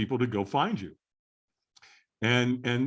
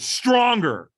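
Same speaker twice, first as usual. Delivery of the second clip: loud, high coaching shout; acted-out trainer's demand; trainer urging harder; 9 semitones higher, 8 dB louder than usual. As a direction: push someone to give more. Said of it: "And he went".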